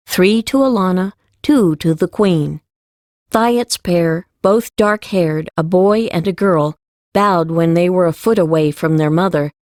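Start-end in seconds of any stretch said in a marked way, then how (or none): none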